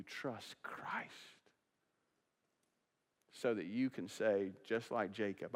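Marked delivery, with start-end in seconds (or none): 0.0-1.1 soft plea. Real, not acted. Pleading altar call.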